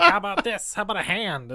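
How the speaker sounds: high mock leprechaun voice. Host retelling the leprechaun's phone gag, high mock creature voice. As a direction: ham up the little creep's taunt.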